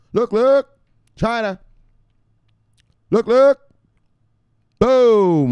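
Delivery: hype shouting. Acted. Hyped crypto prediction.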